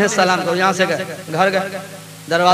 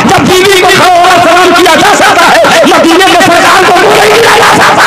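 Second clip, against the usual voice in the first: loud, high, mocking challenge; preaching at full force; 9 semitones higher, 9 dB louder than usual. Preacher taunting the man in the cap, building to a shout.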